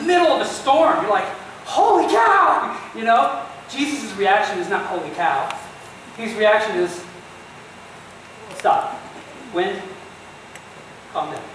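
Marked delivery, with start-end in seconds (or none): 1.6-2.8 high, loud, drawn-out yelp of shock; startled exclamation. Acted. Preacher acting someone woken mid-storm, startled shout. 8.6-11.4 low, unhurried command with long pauses; understated order, dropping softer at the end; calm command. Acted. Preacher as Jesus waking to still the storm, calm and unhurried.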